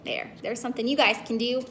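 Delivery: ordinary delivery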